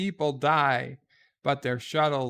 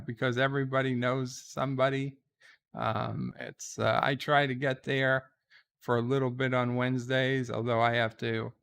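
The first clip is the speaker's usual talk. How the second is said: normal talking voice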